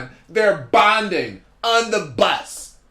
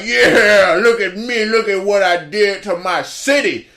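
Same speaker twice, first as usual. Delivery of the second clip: bragging rapper; 3 dB louder than usual. Retold or imitated. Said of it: "he's like".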